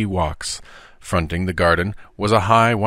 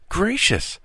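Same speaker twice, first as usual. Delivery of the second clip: astonished; 13 semitones higher than usual. Acted. Tom's astonished exclamation.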